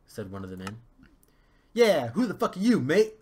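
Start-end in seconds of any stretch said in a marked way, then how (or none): 1.7-3.1 louder, higher rough voice; gruff dwarf challenge. Acted. Second dwarf confronts the prince, loud. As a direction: demand it like a rowdy dwarf. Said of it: "asked another".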